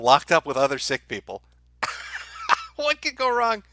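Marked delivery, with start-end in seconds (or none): 1.8-2.7 squeaky mocking giggle, far up in the voice; high mocking giggle. Streamer giggling at his own cruise ship joke, high and mocking. 2.8-3.6 high put-on sarcastic voice, leaning on what; sarcastic mock voice. Streamer mocking the quarantine plan, high put-on sarcastic voice.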